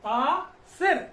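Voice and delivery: Asir stretched very slow; slowly drawn out. Shown as a demonstration. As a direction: draw out Asir to show the र. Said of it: "व के पहले र को जोड़ दे". (आसिर को बहुत धीरे खींचकर)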